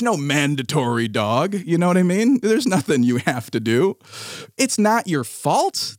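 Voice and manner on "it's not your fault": high, slow mocking singsong; mocking the owner. Mocking the dog owner's cooing to her dog, high and slow. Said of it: "mocking the lady".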